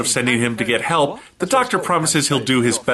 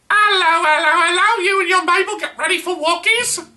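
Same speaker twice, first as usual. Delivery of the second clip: squeaky hammed-up Cockney nursemaid. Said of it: "The Cockney nursemaid".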